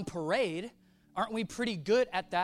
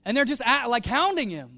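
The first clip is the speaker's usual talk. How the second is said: ordinary talk, nothing marked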